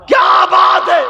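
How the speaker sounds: high, loud questioning cry; urgent shout. Acted. Fatima urgently asking the weeping Bilal, shouted. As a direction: demand the answer with a shout.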